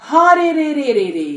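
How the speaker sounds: loud, high, rapid-fire battle cry; explosive war cry. A narrator's reading. Bandits answering the boy's threat, explosive war cry. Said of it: "they just explode with a".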